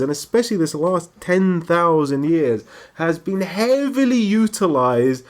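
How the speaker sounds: normal talking voice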